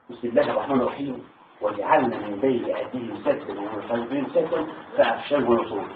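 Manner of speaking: low, soft Quran recitation; quiet prayerful recitation. Retold or imitated. Veteran recalling his battlefield plea to God, quiet recitation.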